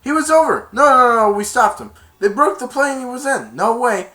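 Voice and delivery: high, loud protest; heated argument. Retold or imitated. Players disputing the touchdown call, loud and high. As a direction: argue the call hard. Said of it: "They said".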